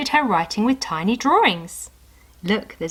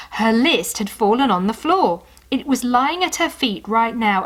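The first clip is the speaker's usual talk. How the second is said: ordinary delivery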